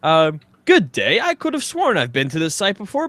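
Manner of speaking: normal talking voice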